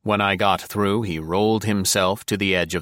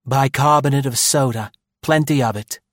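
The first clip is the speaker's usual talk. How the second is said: brisk, crisp order; 6 semitones higher, 4 dB louder than usual. A narrator's reading. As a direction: snap out the order. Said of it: "Maud said crisply to Joe".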